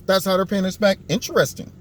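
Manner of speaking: ordinary talk, nothing marked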